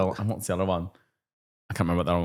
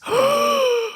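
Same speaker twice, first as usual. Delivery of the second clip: hammed-up gasp. Mock shock at misbehaviour.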